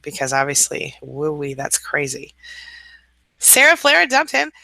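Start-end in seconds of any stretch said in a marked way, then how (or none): 3.4-4.5 high, loud announcement; triumphant announcement; excited cheer. Real, not acted. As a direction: cheer her news out. Celebrating a viewer's breakup.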